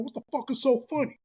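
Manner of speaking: high-pitched indignant demand; retold hot anger. Teen grabbing people's shirts over laughter, mock furious. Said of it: "I'm mad as shit. I'm hot".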